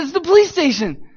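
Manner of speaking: high, loud, giddy reply; goofy grinning cheer. Retold or imitated. Grinning suspect telling questioner where he is, giddy. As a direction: answer brightly through an uncontrollable grin. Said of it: "I can't stop smiling".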